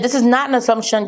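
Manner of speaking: ordinary delivery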